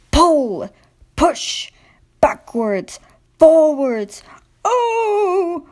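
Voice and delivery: put-on high, strained cries of old trucks; straining trucks. A narrator's reading. Old trucks groaning as Diesel pulls them, high and strained.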